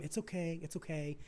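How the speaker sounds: soft hushed comforting; soothing parent. Retold or imitated. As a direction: murmur it like a parent holding a kid with a scraped knee. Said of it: "the parent that goes to the kid".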